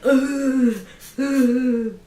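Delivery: loud, high "ugh" sobs; mock tearful groan. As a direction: imitate yourself tearing up, loudly. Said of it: "I was, like, tearing up".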